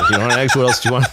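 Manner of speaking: repeating the line through laughter; laughing mock. Repeating the kid question to Letterman through laughter. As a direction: laugh while quoting the fussy parent question.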